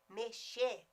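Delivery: normal talking voice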